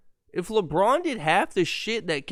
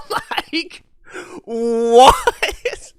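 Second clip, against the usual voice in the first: laughing disbelief; 12 semitones higher, 6 dB louder than usual. Real, not acted. Incredulous laughing outburst.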